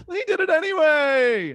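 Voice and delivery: high, loud comedian cadence; exclaimed sitcom-style protest; Seinfeld. Acted. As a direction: push it up high like a sitcom comic. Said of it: "I sounded like Seinfeld".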